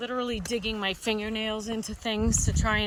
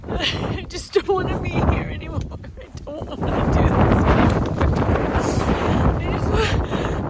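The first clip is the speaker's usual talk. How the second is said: high, tearful, voice cracking; real sobbing breakdown; breaking down crying; 10 semitones higher, 7 dB louder than usual. Real, not acted. Hiker breaks down on mountain.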